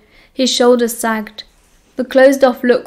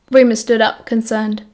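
ordinary delivery, nothing marked

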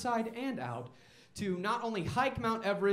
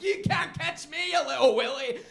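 put-on British accent; 6 semitones higher, 6 dB louder than usual. Boy taunts his friend in a mock British accent. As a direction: tease him in a silly British accent. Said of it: "I'm doing accents".

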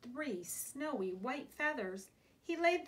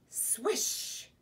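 long airy swish of a swing; whooshing swish. A narrator's reading. Little Mouse swinging as he dreams, airy swish. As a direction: draw out the sh like a swoosh.

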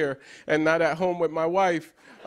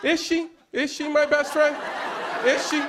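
laughing; 9 semitones higher than usual. Acted. Laughing doubt about wife.

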